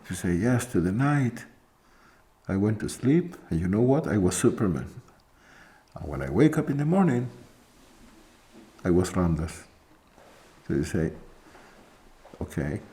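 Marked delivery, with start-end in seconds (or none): none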